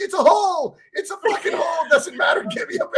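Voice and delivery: high, loud half-laughing shout of pain; frantic yell. Retold or imitated. To his wife while bleeding, frantic loud yelling. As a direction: bark for a bandage through the panic. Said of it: "I'm like".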